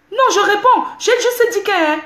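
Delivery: real high, loud retort; indignant insistence. Real, not acted. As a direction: cut in hotly to defend yourself. Insisting on answering back.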